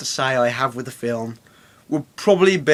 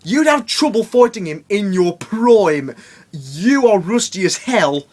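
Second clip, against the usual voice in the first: high, slow scolding; mocking mentor voice; 6 semitones higher, 3 dB louder than usual. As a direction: scold him like a weary trainer. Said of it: "Not in those words, but that's basically what he says".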